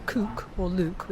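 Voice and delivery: "cook" and "luke" with a long OO, as in "boot"; Cheshire pronunciation. Shown as a demonstration. Londoner showing Cheshire speech, "cook" and "luke" with long OO. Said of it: "which is a, certainly a Cheshire".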